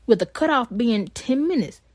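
ordinary talk

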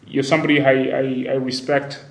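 normal talking voice

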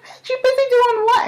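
high, sharp mock disbelief; incredulous. Mother retorting to her daughter, high and incredulous. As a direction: act stunned at the toddler's excuse. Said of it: "And it's like".

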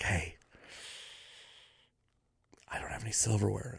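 very soft, low and quick; hushed request. Retold or imitated. Diner quietly asking waitress for silverware, hushed. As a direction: ask discreetly, afraid to make trouble. Timid request, avoiding angering the boss.

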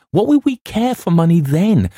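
excited. A narrator's reading. Narrator's growing manic outburst.

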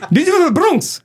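high-pitched broken-English cabbie; cabdriver's foreign accent. Retold or imitated. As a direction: imitate a heavily accented immigrant cabdriver. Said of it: "they say".

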